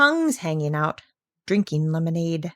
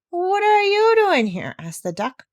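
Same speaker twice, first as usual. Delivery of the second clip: surprised scold; 6 semitones higher than usual. Acted. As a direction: catch the stowaway in the act. Duck finds stowaway mouse.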